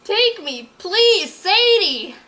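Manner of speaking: high, loud eager plea; eager begging. Acted. Berkeley begging Sadie to take him, high eager plea. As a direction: beg excitedly.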